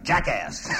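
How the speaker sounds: mild mock shout of an insult; retold shout. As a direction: toss it out as mother's exclamation. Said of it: "you can hear her shouting".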